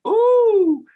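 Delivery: very high, loud delighted ooh; a big surprised squeal; puppet surprise. Shown as a demonstration. Puppet opening its wings for a big surprise. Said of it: "he doesn't open his hands until".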